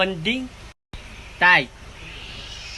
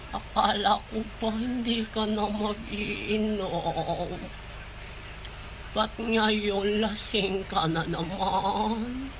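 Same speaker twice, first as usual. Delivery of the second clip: tender; 9 dB quieter, much slower than usual. Real, not acted. Child gently scolding drunk father.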